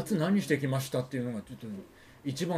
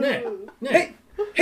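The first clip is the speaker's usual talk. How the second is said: voicing hidden servant; 10 semitones higher, 9 dB louder, much slower than usual. Voicing the hidden chair-man.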